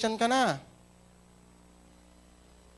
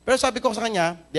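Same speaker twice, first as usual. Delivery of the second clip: ordinary delivery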